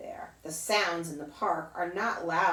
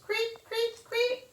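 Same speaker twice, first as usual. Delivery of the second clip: high chirping cricket; slower than usual. Retold or imitated. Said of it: "The cricket goes".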